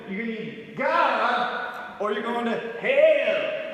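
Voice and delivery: slow, drawn-out mock preacher twang on God and hell; mock preacher accent. Mocking street preachers telling people they need God, slow twang. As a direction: preach damnation slowly, hamming the accent. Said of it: "Those two things that have an accent".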